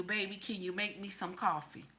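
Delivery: husband's request. Retold or imitated. Husband asking his wife for coffee, softly. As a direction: murmur it gently like a husband. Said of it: "He say to you".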